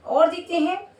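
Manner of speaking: normal talking voice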